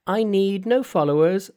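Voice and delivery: high, perky monkey king voice; cheeky monkey hero. Acted. Monkey's voice in read story.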